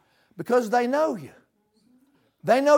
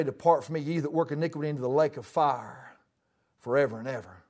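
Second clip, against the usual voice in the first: low, grave and quieter judgement; voice of God; 5 semitones lower, 6 dB quieter, faster than usual. Acted. God condemning the unsaved, low solemn sentence. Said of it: "God's gonna say".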